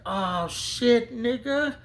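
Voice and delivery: high, loud startled yelp; alarmed shout. Retold or imitated. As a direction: yelp it as your ride pulls away. Friend drives off without him.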